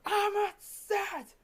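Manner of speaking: very high, slow, strained cry. Retold or imitated.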